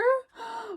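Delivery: high, sharp storytime gasp; playful gasp before the big sneeze; mock gasp. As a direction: gasp to build suspense for the kids.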